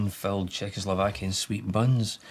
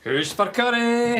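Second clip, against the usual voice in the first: calling out; 14 semitones higher, 5 dB louder, slower than usual. Acted. Dad calls out offering curry.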